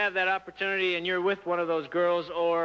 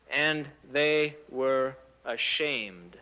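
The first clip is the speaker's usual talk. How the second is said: normal talking voice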